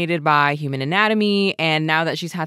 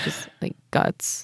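whisper; 7 dB quieter, slower than usual. Real, not acted. Whispered aside about the sketch.

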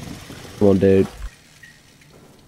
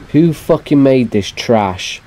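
ordinary talk

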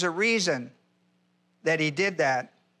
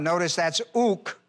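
usual speaking voice

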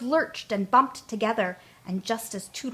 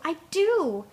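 higher, happy insistence; delighted; 7 semitones higher, 3 dB louder than usual. A narrator's reading. Tootle among buttercups, gleeful. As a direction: repeat it with glee. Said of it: "cried Tootle".

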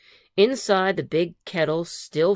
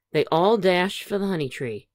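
usual speaking voice

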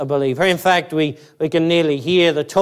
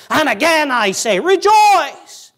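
climbing to a high, loud cry on rejoice; passionate preacher; 11 semitones higher, 4 dB louder than usual. Acted. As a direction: proclaim it like Paul bursting with joy. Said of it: "You hear the preacher's voice, you hear the passion".

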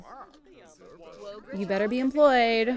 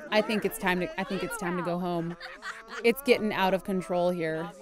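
ordinary talk, nothing marked

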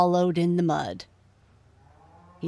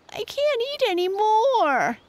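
high whining piglet voice, a little louder; whiny piglet; 4 dB louder than usual. Acted. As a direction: whine it like a stuffed little pig. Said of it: "he whined".

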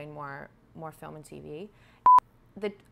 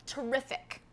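put-on a higher-pitched British accent, proud; 8 semitones higher than usual. Actress proving her accent to the host in British. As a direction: boast it in your Mary Poppins accent. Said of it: "Is your accent any good?".

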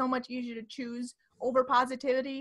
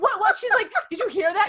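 startled; 7 semitones higher, 7 dB louder than usual. Retold or imitated. Retold startled exchange at night.

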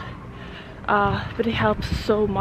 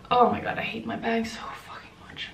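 whispering; 6 dB quieter, faster than usual. Real, not acted. Muttered complaint walking.

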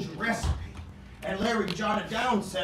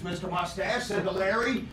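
normal talking voice

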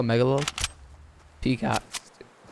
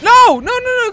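high, loud panicked nos; real panic, loud and high; 12 dB louder than usual. Real, not acted. Panicking while taking damage.

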